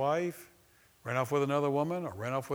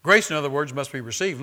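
"Grace" called out much louder; emphatic repeat; 9 dB louder than usual. Acted. Preacher repeats "Grace" to his congregation, much louder. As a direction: belt out "Grace" to drive the point home.

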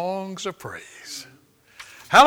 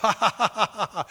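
even, deliberate run of "ha"s; imitated laugh of faith; 4 semitones higher, faster than usual. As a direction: imitate a dry, purposeful laugh. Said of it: "just began to laugh".

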